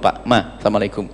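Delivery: soft, polite announcement; gentle husband. Pretend. Husband courteously warning his wife he'll be angry later, soft and gentle. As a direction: ask her kindly if she is ready.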